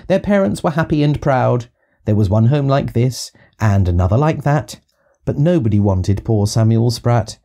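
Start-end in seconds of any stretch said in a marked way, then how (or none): none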